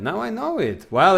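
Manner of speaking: usual speaking voice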